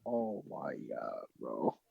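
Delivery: slow, hushed disbelief; real breath-only whisper of disbelief; stunned whisper. Real, not acted. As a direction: whisper it low, slowly, in shock. Friend stunned by the story.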